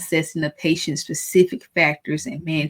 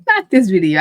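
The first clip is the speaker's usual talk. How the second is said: Not this video, said louder and firmly; louder repeat; 3 semitones higher, 9 dB louder than usual. Real, not acted. Teacher insisting to viewers, louder. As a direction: repeat it louder through the laugh. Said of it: "but not this video".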